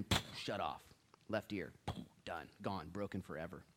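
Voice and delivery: ordinary delivery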